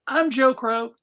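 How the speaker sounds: ordinary delivery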